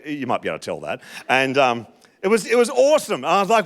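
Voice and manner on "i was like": normal talking voice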